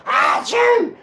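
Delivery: high, loud, slow fake sneeze; mock sneeze shout. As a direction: blurt it like a huge sneeze. Acted mock sneeze in quote.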